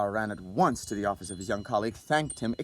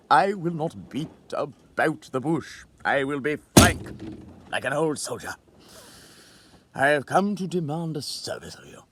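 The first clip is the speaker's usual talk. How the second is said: put-on frank old soldier; 4 semitones higher, 5 dB louder than usual. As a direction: speak plainly like an old soldier.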